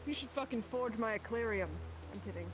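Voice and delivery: ordinary delivery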